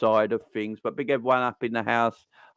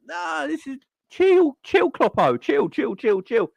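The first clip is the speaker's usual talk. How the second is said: mock calming; 13 semitones higher, 4 dB louder, slower than usual. Telling Klopp to chill.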